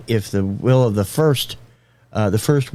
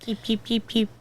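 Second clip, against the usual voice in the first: high soft canary chirps; cartoon bird voice; 13 semitones higher, 8 dB quieter than usual. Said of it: "My Tommy Wiseau impression".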